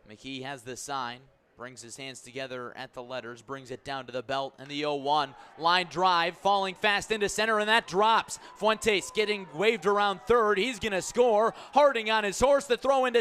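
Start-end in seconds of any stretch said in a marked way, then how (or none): none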